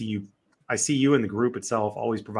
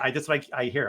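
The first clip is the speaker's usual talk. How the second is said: usual speaking voice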